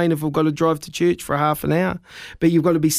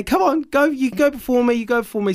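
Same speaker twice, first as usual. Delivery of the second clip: higher, eager church-folk politeness; polite insistence; 5 semitones higher than usual. Retold or imitated. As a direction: mimic kindly people waving him ahead.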